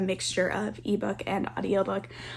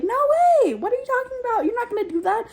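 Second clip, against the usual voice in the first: high-pitched mock-incredulous refusal; 13 semitones higher, much faster than usual. Traveller turning down a stranger's boat invitation, high-pitched incredulous refusal.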